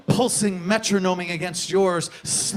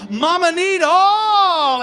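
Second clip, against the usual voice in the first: Mama need all stretched out slowly, much higher and louder; drawn-out cry; 8 semitones higher, 6 dB louder, much slower than usual. Retold or imitated. Poet voicing his demanding lover, drawn-out high wail. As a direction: wail each word long and high. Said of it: "And she says".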